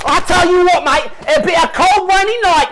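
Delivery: outraged English lad; indignant English accent. Acted. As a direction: defend the Queen, huffing. English guest bristling.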